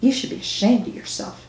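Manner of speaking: quiet, tart scolding. A narrator's reading. Cashier shaming returned customer, quietly tart. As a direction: softly shame them, with a sting. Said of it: "Her tone had grown noticeably tart".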